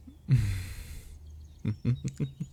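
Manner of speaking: guilty little laugh, rising; sheepish laugh. Real, not acted. Caught by her friend, sheepish laugh. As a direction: laugh like you've been caught out. Caught fibbing about the wedding.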